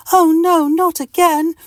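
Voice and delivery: panicked squeal, very high and loud. Acted. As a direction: squeal in terror as the nails strike. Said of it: "squeals Mister Itch".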